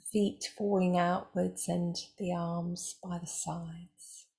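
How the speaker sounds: calm, unhurried voice with long pauses; guided meditation. Acted. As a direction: guide them gently into stillness, leaving space between phrases.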